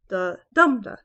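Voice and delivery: "da-dum-da" with a loud middle "dum"; middle stress. Shown as a demonstration. Teacher showing types of feet, stressed middle beat in "da-dum-da". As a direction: punch the centre of "da-dum-da". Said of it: "we have different types of feet".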